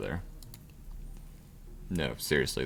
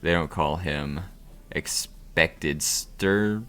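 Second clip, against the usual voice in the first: game narrator; 3 semitones lower than usual. Acted.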